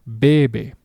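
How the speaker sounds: bebe, first "be" stressed, second light; stress on first syllable. Shown as a demonstration. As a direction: lean on the start of bebe. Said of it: "natural pronunciation of this word".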